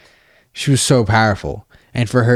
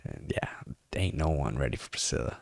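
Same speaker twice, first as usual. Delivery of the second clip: low, soft whispered warning; hushed ominous aside; 8 semitones lower, 13 dB quieter than usual. Real, not acted. Warning the listeners about Priscilla, hushed. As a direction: drop to a spooky murmur. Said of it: "low whispered tease".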